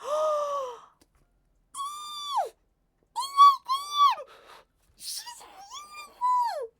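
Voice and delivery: very high, loud squealing delight; excited squeal. Real, not acted. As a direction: shriek with real joy at the cute animal. Reveal of a new baby animal.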